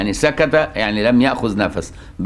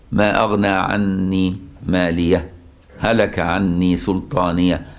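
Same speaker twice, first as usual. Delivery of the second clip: slow, lower chanted recitation; Quranic recitation; 5 semitones lower, slower than usual. Shown as a demonstration. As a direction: recite it slowly, joining with a brief stop. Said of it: "كتابية".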